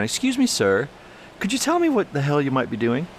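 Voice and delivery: mock polite. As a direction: pretend to ask ever so politely. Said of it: "I know I should have been".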